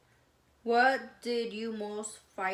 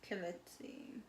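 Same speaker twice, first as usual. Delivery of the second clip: whispered; 3 semitones lower, 10 dB quieter than usual. Real, not acted. Reading questions off phone.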